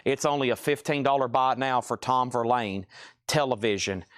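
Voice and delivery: normal talking voice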